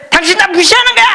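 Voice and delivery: indignant husband. Acted. Husband accusing his tired wife of disrespect, high indignant shout. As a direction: throw the accusation like he's been slighted. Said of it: "그러면서 뭐라 그러는지 아세요?".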